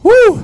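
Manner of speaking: high, loud whoop; excited woo. Real, not acted. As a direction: let out a big party whoop. Cheer after beers.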